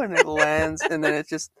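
normal talking voice

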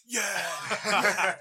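high, loud, laughing yell; triumphant yell. Retold or imitated. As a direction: yell it like a show-off. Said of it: "he was flexing his arms and goes".

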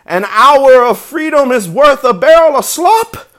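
high, very loud goose cry; honking goose. A narrator's reading. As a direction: proclaim it as an impassioned goose. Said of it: "honked the goose".

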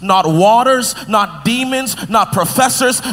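full-force sermon climax; preaching. Acted. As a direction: drive it home to the congregation.

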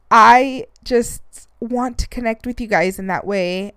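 ordinary talk, nothing marked

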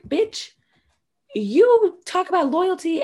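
high, indignant call-out; mock outrage. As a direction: imitate a fed-up friend snapping back. Heather finally turning on Jen.

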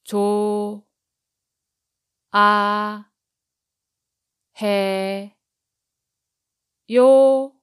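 조, 아, 해, 요 each syllable said separately and loudly; 조 아 해 요 split into four loud separate syllables. Shown as a demonstration. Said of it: "break it into syllables so that you can repeat after me".

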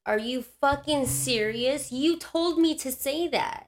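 slow, high-pitched indignant accusation; re-enacting a heated confrontation; outraged disbelief. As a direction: stretch the words in incredulous protest. Retold confrontation with intake lady.

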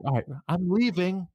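loud, high mock huff; mad retelling. Angry coworker quitting the argument and walking out, loud high huff. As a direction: announce it in an imitated angry outburst. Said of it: "she gets so mad".

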